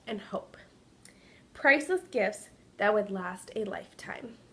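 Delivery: expressive read-aloud storytelling voice; story narration. A narrator's reading. Teacher reading a picture book to kindergartners, story narration. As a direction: tell it like a picture book to little kids.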